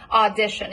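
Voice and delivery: "Audition" with a full, pure ah as in father; don't reduce the first vowel of "Audition". Shown as a demonstration. Coach showing an unreduced first vowel in "Audition". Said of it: "pure ah as in father".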